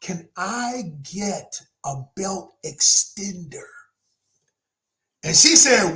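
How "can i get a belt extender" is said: slow, whispered request; very softly. Retold or imitated. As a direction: whisper it slowly so nobody nearby hears. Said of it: "I said it very softly".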